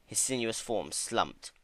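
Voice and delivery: plain reading voice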